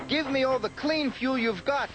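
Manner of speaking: urgent cry. A narrator's reading. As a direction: demand it, it's an emergency. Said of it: "Thomas cried".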